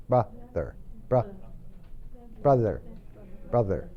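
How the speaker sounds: brother, tongue just between the teeth; TH demonstration. Shown as a demonstration. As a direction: show brother with a light TH. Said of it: "poner esa lengua dentro de los dientes".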